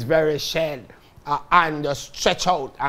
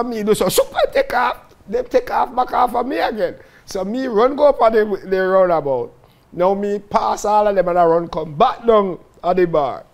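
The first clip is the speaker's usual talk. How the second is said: normal talking voice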